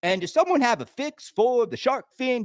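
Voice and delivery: normal talking voice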